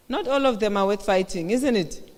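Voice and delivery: ordinary talk, nothing marked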